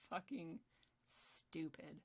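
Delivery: low, soft, spaced-out contempt; hushed disgust. Real, not acted. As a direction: let it drop almost under the breath. Disgusted repeat after a laugh.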